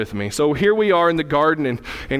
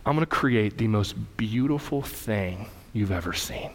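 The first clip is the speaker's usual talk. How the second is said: hushed promise; 6 semitones lower, 6 dB quieter than usual. Acted. God unveils a plan to Adam.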